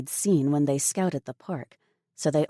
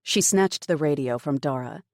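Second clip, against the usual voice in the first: usual speaking voice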